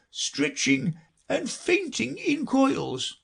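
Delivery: slow, high, wavering list; Mock Turtle. A narrator's reading. Mock Turtle counts off subjects for Alice, slow high wail. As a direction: count them off in a drawn-out doleful voice. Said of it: "counting off the subjects on his flappers".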